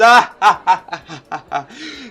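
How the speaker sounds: head-back hearty laugh. Acted. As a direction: laugh out loud with gusto. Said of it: "throws back his head and laughs".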